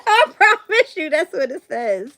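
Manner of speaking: giggling through the words, high; laughing disbelief. Real, not acted. Insisting to viewers the card said it, laughing through the words. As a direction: laugh while insisting it's true.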